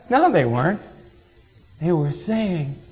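normal talking voice

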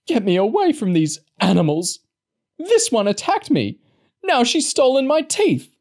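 put-on old woman shrieking complaints to the vet about the girls; hysterical complaint. A narrator's reading. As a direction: whine and shriek like an offended old lady.

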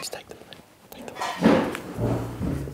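pleading slow whisper. Acted. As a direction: slip it to him quietly. Secret bribe to plumber.

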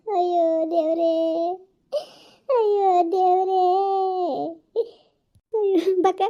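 slow, tearful moaning; mild weeping; tearful lament. Acted. Woman telling Nagakka her limbs won't move, mild weeping. Helpless crying, limbs shaking.

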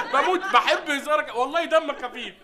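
laughing fit at a joke; laughing hard. Acted. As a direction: crack up and talk through the laugh. Laughing at the other man's joke.

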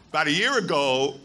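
ordinary delivery

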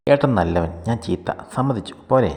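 peeved little sister giving up; sulky retort. A narrator's reading. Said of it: "അവൾ പിണങ്ങിപ്പോയി".

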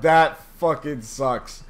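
usual speaking voice

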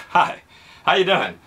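ordinary delivery

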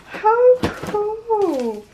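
high, drawn-out thrilled squeal; delighted squeal. Real, not acted. Shopper opening a surprise gift from Paris, delighted squeal. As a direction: coo it high, amazed by the parcel.

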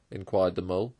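ordinary delivery, nothing marked

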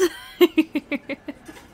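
loud high giggle, breaking up; can't hold the laugh in; burst of laughter. Real, not acted. Laughing at herself after almost kissing her partner. Laughs at own near-kiss.

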